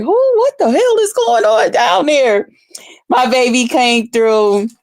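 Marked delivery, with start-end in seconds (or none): 0.0-2.5 high, alarmed "Who?"; startled neighbors. Retold or imitated. Imitating neighbors hearing the noise, high and alarmed. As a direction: play the puzzled neighbors. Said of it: "They probably was like".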